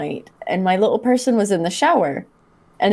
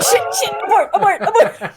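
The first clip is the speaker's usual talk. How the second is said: panicked abort; 13 semitones higher than usual. Retold or imitated. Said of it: "And I was like".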